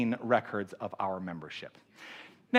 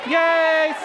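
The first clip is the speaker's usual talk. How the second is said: loud excited cheer, higher; 9 dB louder than usual. Real, not acted. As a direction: cheer her onto the stage. Cheering speaker onto stage.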